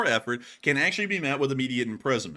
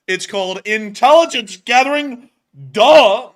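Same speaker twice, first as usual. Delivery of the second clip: much higher and louder, sneering duh; mock the chatter's know-it-all tone; mocking smug voice; 10 semitones higher, 15 dB louder, slower than usual. Host reading a chat comment aloud, mocking sneer.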